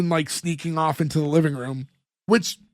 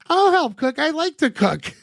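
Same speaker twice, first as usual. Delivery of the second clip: much higher, childlike imitation; little girl voice; 8 semitones higher than usual. Host imitating the daughter offering to cook, high little-girl voice. Voicing the young daughter's eager line.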